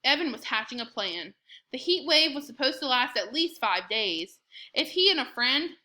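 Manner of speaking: ordinary delivery, nothing marked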